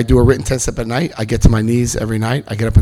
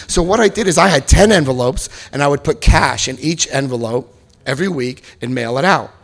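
usual speaking voice

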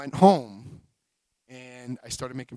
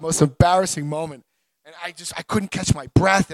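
ordinary talk

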